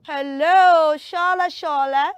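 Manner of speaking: loud, drawn-out hello; hailing a friend; calling out. Acted. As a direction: call out to Shola loudly. Calling out to Shola.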